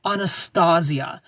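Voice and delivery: Anastasia said "ah-nuh-STAH-zee-uh"; drawn-out Anastasia with open "ah" and a Z; insisted pronunciation. Retold or imitated. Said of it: "her name is pronounced".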